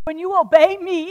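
loud, high, fervent sermon delivery; impassioned preaching. Acted. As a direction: preach it with rising passion. Preaching at full force.

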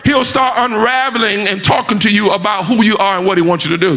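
rising, shouting sermon cadence; preaching at full force. Acted. Preacher urging the congregation to trust God, full force.

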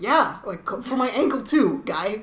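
loud, high mock indignation; hammed-up outraged retort; indignant. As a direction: act out an irritated mom snapping back. Retelling injured mom snapping back.

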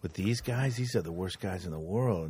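low, hushed retelling. Retold or imitated. Podcaster quoting Watchmen prison talk, low and hushed. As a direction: drop right down into a quiet voice.

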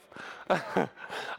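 brief breathy laugh after a joke; quick little laugh; short chuckle. Real, not acted. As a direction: chuckle at your own aside. Jokes about his wife being best mom.

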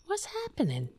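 high, calling mother voice, rising to a shout. Real, not acted. Calling the cat in a high mother voice. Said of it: "That mother voice".

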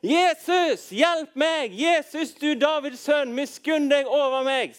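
high, loud cry for help; drawn-out, high-pitched pleading shout; desperate calling out. Acted. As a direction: call out to Jesus across a crowd. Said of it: "Og da begynner han å rope".

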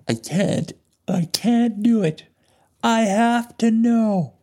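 high, strained, drawn-out cry; desperate plea. Acted. Rex pleads with the abductor to know, desperate. Acting Rex's desperate refusal.

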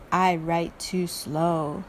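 low, dragging turtle voice; slow turtle. Acted. Turtle turns down the iguana's request, slow and low. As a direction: draw it out slowly and low, like a turtle.

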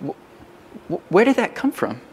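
stammered, baffled question to yourself; wondering. Acted. Person startled by their own reflex answer, acted bewilderment. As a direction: falter on the first word, puzzled. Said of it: "you're wondering".

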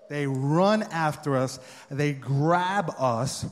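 normal talking voice

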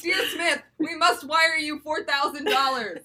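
slow, shouted scammer voice, high; mock scam email voice. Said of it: "I sound like a spammer from Nigeria".

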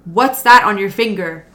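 loud startled whoa; shocked Sam. Retold or imitated. Sam spotting the engagement ring, loud and startled. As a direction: blurt it out, alarmed. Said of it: "Sam's like".

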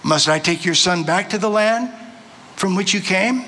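deliberate reading with long pauses and sweeping pitch; scripture reading. Real, not acted. Preacher reading Genesis aloud to the congregation, measured and dramatic. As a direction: read it out measured, lifting key phrases.

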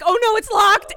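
high, loud dismayed wail; hammed-up desperate oh-no; retold panicked cry. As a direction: cry out in mock alarm. Retold panic at the locked door.

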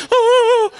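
very high, loud "ohh" of someone jolted awake; hammed-up high startled wail; startled grandma shriek. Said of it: "And she was like".

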